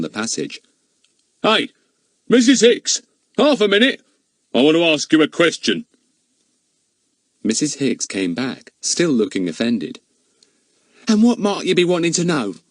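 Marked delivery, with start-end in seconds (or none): 1.4-5.9 louder and higher, calling down the passage. Acted. Policeman calling the housekeeper back down the passage, raised voice. 11.1-12.6 high, chatty housekeeper protesting. Acted. Charwoman contradicting the village policeman, high and eager.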